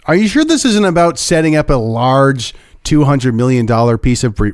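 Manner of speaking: ordinary delivery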